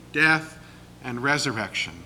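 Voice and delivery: ordinary talk, nothing marked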